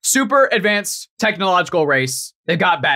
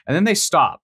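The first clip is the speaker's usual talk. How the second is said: usual speaking voice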